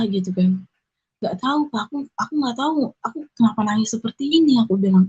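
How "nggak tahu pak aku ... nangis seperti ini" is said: higher, softer re-enactment of confusion; retold tearful bewilderment. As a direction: mildly act out helpless, tearful confusion. Menirukan dirinya menjawab sambil menangis. (menirukan diri yang bingung menangis, pelan)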